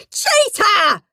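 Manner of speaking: high angry howl back; boy howling cheater at Margaret. A narrator's reading. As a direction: fling the word cheater right back. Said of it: "howled horrid Henry".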